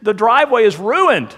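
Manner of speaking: high-pitched, exasperated outburst; angry father yelling. Acted. Father yells at his child over ruined concrete, acted anger. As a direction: scold in dismay, voice leaping right up. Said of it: "begins yelling at his child".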